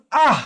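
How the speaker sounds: আহ ending in an audible h; final h sound. Shown as a demonstration. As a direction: let breath close আহ as a হ. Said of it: "হ ধ্বনির মতো". (আহ-র শেষে হ ধ্বনি)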